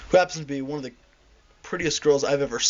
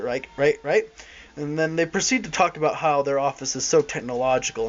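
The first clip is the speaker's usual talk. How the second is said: ordinary delivery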